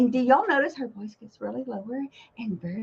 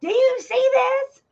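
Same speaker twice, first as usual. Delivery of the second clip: yelling; 13 semitones higher, 4 dB louder, slower than usual. Retold or imitated. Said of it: "yelling at him".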